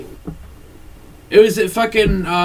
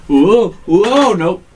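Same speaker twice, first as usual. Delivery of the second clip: mock hesitant wrestler; 4 semitones higher, 7 dB louder, much slower than usual. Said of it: "Every single one of 'em are like".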